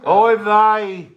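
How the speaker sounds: put-on Yiddish accent.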